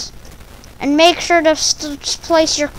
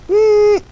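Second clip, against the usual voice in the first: censor hum; 7 semitones higher than usual. Acted. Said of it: "I can't even call it by its own name".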